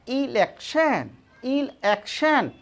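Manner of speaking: इलेक्शन drawn out slowly and carefully; slow pronunciation. Shown as a demonstration. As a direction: model इलेक्शन slowly for the class. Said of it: "इस तरह का कहना".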